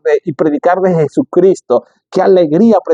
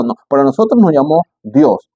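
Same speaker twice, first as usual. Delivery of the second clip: normal talking voice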